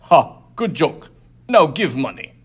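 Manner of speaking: loud sneering demand; mocking brute. Diumph mocks and demands.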